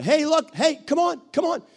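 rally a hesitant person to come along; excited coaxing call. Retold or imitated. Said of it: "We'll take somebody like this and say".

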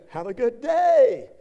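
strained politeness. Acted. Forced forgiveness, strained.

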